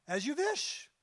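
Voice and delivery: a hint of a Yiddish-accented God. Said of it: "He's Yiddish".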